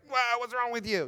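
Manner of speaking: exasperated snap. Retold or imitated. Parent losing patience with a child over math, exasperated. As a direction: let the frustration spill out, half-acted.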